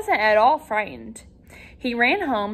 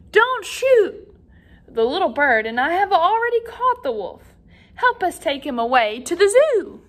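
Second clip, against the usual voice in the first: calling out; 6 semitones higher than usual. Acted. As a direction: shout down to the hunters to stop. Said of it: "Peter called to them from the tree".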